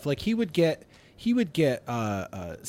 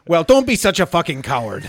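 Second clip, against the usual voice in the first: louder, higher mock-Swedish scolding; imitated angry Swede; 4 semitones higher, 8 dB louder than usual. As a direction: berate them as the irate Swedish coworker. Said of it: "And he said".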